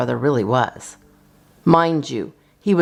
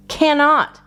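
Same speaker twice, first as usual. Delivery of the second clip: put-on high, insistent little-girl retort; childish protest; 13 semitones higher, 3 dB louder than usual. A narrator's reading. Little sister snapping back at her brother, insistent and high. Kids' bickering match.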